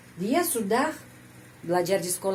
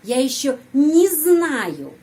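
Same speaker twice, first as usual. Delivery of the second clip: "не знаю" said as one unit, higher, louder and slow; linked не; 5 semitones higher, 7 dB louder, slower than usual. Shown as a demonstration. Teacher demonstrates joining не to the verb. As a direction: bind "не" tightly to "знаю". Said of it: "langsung, ya, sambung".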